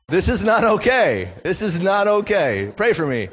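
high, loud comic protest; hammed-up exasperated dad; mock outrage. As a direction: protest loudly as if deeply wronged. Acted outrage at son's retort.

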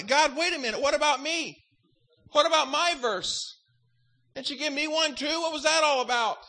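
acted-out protest to God; indignant questioning. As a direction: plead your own case, mildly exasperated. Acts out his complaint to God.

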